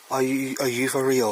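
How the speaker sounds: loud startled disbelief; jolted by a sudden noise. Real, not acted.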